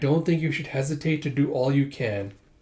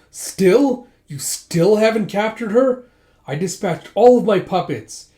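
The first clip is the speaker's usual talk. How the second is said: shrieking furious boss, much louder and higher; mock villain rage; 7 semitones higher, 8 dB louder than usual. Villain berating his men, hammed-up furious yell. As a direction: rage at the failed puppets. Villain raging at failed assassins.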